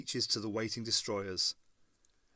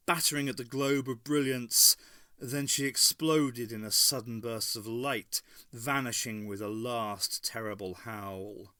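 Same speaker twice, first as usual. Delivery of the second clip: usual speaking voice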